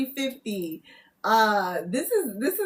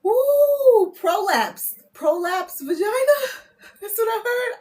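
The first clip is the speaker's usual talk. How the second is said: higher and louder, startled at the word; shocked outburst, higher and louder; surprised; 9 semitones higher, 6 dB louder, slower than usual. Real, not acted. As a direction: blurt it out, shocked at yourself. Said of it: "I don't even talk like this".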